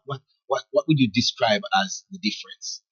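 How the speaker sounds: ordinary delivery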